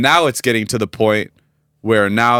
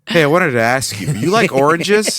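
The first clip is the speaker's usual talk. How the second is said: mock abrupt transition; 5 semitones higher than usual. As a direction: parody his sudden topic change. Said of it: "I like when he, like, transitions like that".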